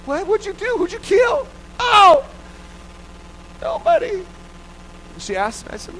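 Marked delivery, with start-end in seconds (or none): 0.0-1.5 alarmed mom. Retold or imitated. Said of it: "She's like". 1.8-4.2 put-on high, wailing child; a teary child's reply; crying child. Child answering mom, crying.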